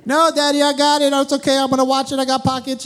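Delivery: put-on high-pitched little boy voice; Aiden. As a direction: imitate a confident kid reassuring his dad. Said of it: "Aiden likes all his toys".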